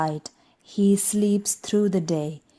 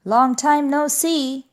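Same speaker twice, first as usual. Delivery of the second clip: cheerful hare; 7 semitones higher, 4 dB louder than usual. Acted. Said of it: "says Hare".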